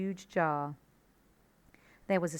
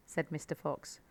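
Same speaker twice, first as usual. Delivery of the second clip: ordinary delivery, nothing marked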